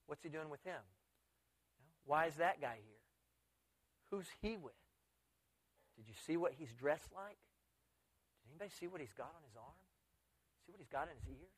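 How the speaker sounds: hushed, disapproving asides; gossiping murmur. Acted. Churchgoers whispering about an unwelcome guest, hushed disapproval. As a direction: mutter questions behind someone's back.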